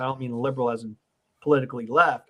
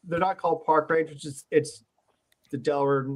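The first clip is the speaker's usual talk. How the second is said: normal talking voice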